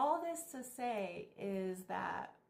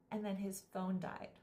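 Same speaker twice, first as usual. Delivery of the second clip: normal talking voice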